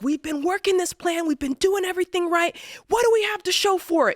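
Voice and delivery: venting that all the work shows nothing; frustrated crying rant in the hot car; retold angry rant. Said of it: "I was so angry".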